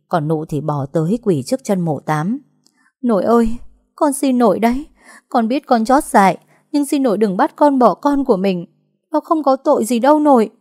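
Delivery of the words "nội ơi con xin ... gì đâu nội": high, louder tearful begging; desperate pleading. A narrator's reading. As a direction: beg on your knees for the baby. Kneeling, begging her grandmother.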